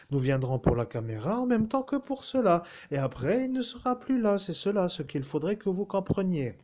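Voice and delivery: captain's voice. Acted. Voices Captain Fabin at length.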